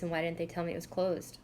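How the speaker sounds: ordinary talk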